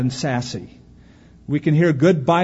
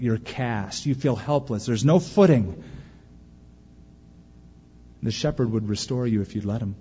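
ordinary delivery

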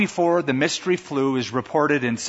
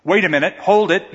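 raised voice calling after them; insisting call; 5 semitones higher, 6 dB louder than usual. Acted. Father calling after the nurses, raised voice. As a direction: stop them, loud and urgent. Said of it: "you insist as you follow".

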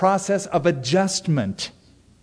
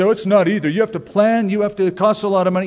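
higher, louder, hurried protest; mock objector; 5 semitones higher, 4 dB louder, faster than usual. Preacher voicing a doubting listener, hurried protest. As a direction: play the listener pushing back, fast. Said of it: "You say".

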